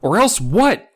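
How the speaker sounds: high, loud frightened question; cry out. Acted. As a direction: demand it in panic. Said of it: "I cried".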